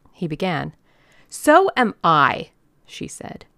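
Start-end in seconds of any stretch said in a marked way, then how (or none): 1.3-2.5 slow, loud comeback; forceful retort. A narrator's reading. Old lady interrupts the organizer's excuse, forceful. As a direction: come back hard, each word weighted.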